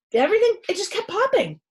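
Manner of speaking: excited climax. A narrator's reading. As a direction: rush it with excitement. Popping builds to climax.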